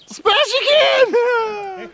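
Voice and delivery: very high, slow squealed call; drawn-out shriek. Pretend. Calling to smash again in a drawn-out shriek. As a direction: stretch the words into a high shriek.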